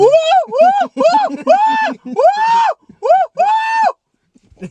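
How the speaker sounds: very high squeaky woo calls; bat impression. Shown as a demonstration. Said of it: "do your best bat impression".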